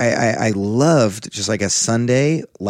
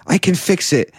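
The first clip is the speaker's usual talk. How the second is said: chirpy confident; 9 semitones higher, 3 dB louder than usual. Retold or imitated. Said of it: "she goes".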